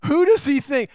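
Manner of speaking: mock the outraged religious critics; indignant outburst. Scene: religious leaders scoff at Jesus forgiving sins, indignant outburst. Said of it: "religious spirit".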